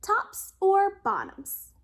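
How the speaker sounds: drawn out, high and loud; teasing slow question. A narrator's reading. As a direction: dangle the choice slowly. Hare pushes choice.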